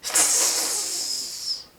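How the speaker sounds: long, soft, breathy steam hiss; rude hiss. Acted. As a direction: hiss like an engine letting off steam. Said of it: "hissing rudely".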